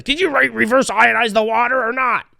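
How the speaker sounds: high, nasal, fussy mock; mocking nerd voice. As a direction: needle her like a fussy nerd. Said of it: "he's like".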